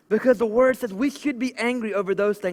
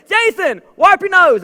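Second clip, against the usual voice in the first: loud friendly callout; 8 semitones higher, 8 dB louder than usual. Retold or imitated. Friend kindly pointing out booger.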